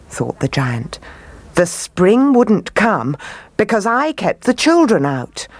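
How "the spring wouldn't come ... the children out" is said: dawning realisation. A narrator's reading. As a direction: work it out aloud, pitch lifted. Giant realises his own mistake.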